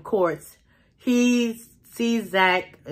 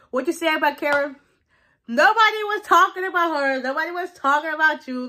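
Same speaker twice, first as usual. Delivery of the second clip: high-pitched retold squabble; mock confrontation; 7 semitones higher than usual. Imitating men bickering about Karen, high and confrontational. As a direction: act out a testy back-and-forth, pitched up. Acting out a courtside quarrel.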